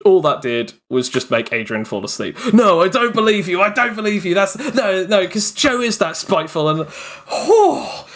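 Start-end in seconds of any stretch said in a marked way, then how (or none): 2.5-6.7 high, heated denial; angry disbelief. Retold or imitated. Furious player refusing to believe it was a joke. 7.3-8.1 very high, horrified cry; a cry of dread at the memory; horrified oh God. Real, not acted. Remembering the player's explosive rage with a high cry. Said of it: "radiation coming off ... nuclear explosion".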